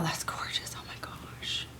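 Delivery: soft hushed whisper of wonder; awed whisper. Real, not acted. Painter whispering in awe at colors blending. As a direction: whisper it like you can't believe it.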